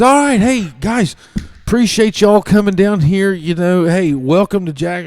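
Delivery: high, loud speech to parents; gruff coach welcome. Retold or imitated. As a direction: address the crowd like the coach.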